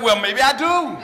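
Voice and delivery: loud laughing admission. Retold or imitated. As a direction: give in with a laugh. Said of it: "another one just went out and said".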